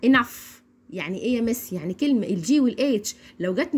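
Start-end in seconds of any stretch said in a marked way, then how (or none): 0.1-0.6 "enough" ending on a clear f; teacher's model "enough", f at the end; final GH as F. Shown as a demonstration. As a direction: say "enough" as "inaf". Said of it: "بيبقى نطقها كالاتي".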